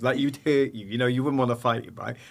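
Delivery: ordinary talk, nothing marked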